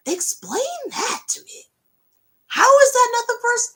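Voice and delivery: high, loud incredulous squeal; mock outrage. Real, not acted. Fan ranting at Spotify about the playlist, high outraged squeal. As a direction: squeal in disbelief. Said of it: "Anyway, I digress".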